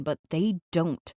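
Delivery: ordinary delivery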